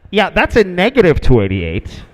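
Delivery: annoyed controller. Retold or imitated. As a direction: turn them down with growing impatience. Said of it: "Like he was getting annoyed".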